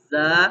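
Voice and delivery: "زا" said clearly for repetition; slow clear "زا" to copy; model letter. Shown as a demonstration. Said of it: "پہلے ... پڑھیے گا".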